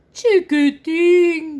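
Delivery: calling out. Acted. Mother elephant calls back to her baby, drawn out. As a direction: call his name across the jungle. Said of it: "grita Elefanto".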